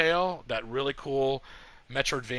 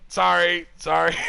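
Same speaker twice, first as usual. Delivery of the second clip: louder, higher, sheepish mock apology; flustered apology; 5 semitones higher, 9 dB louder than usual. Walking in on a friend changing, an embarrassed louder apology. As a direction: blurt out sorry, embarrassed.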